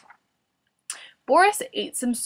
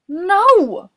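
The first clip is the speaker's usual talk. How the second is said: high, loud cry of denial; shouted; 9 semitones higher, 9 dB louder than usual. A narrator's reading. Boris answering whether beds are lazy, shouted. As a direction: shout it out in protest. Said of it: "Boris shouted".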